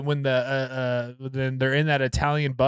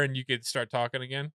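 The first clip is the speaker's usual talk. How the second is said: ordinary delivery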